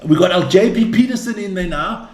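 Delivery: ordinary talk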